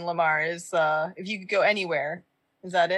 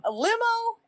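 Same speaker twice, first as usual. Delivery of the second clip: a limo, really?; high incredulous squeak; surprised question. Real, not acted. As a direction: leap way up in disbelief.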